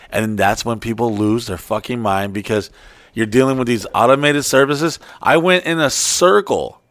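ordinary talk, nothing marked

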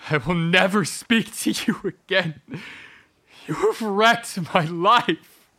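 high, loud, slow final verdict; furious shout. Acted. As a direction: deliver it like a sentence. Husband's final rage.

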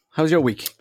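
ordinary delivery

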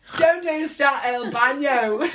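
loud, high, drawn-out bathroom plea; desperate mangled Spanish. Retold or imitated. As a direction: call it out slowly and high to a baffled local. Desperately asking in remedial Spanish.

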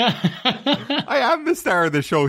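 high, slow, showy boast; a high slow mock brag; mock grandiose. Mocking mum's praise.

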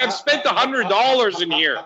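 indignant, high; retold angry scene. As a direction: pitch it up in indignation. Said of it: "I had a full on scene".

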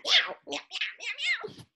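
high, drawn-out cat meows. Shown as a demonstration. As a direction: meow like a playful cat. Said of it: "Remember, you gotta do the hands".

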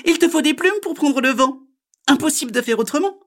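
very high, loud bird voice; know-it-all magpie. Acted. Magpie fools the frog, high loud bird voice. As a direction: squawk it out as a magpie.

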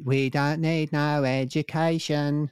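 mock Cockney kid choir, slow; sing-song Cockney street urchin; lightly voiced London kids' choir. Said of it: "sound of Cockney street urchins".

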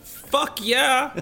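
high, loud, emphatic yes; excited yell. Real, not acted. Guest jumping at the helicopter job, excited shout. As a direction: yell it out with full enthusiasm. Eager answer to job offer.

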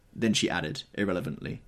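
ordinary delivery, nothing marked

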